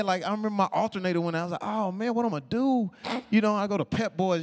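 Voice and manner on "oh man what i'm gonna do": higher-pitched, mock-worried lament; retold worry. Broke comedian recalls his dead alternator, mock worry. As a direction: fret over it like a broke car owner.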